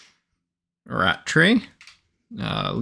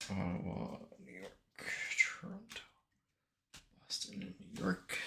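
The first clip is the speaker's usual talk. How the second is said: muttered to self; 6 semitones higher, 18 dB quieter than usual. Real, not acted. Muttering teams while sorting cards.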